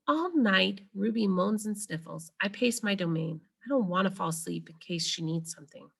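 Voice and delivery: ordinary delivery, nothing marked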